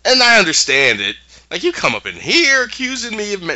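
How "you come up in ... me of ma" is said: higher indignant protest; lightly voiced offended witch; mock indignant witch. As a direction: bristle at the accusation. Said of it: "she feels".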